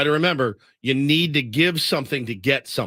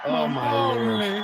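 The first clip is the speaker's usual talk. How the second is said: high, slow Southern swoon; hammed-up Southern accent; mock Southern exclamation; 9 semitones higher, much slower than usual. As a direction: drawl it out in mock Southern dismay. Mocking the clip's Southern accent.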